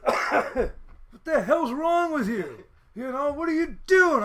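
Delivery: high, loud mock yell; imitated yelling cop. Cop scolding a speeding student, mock yelling. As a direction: demand it like an exasperated cop. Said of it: "he's yelling at me like".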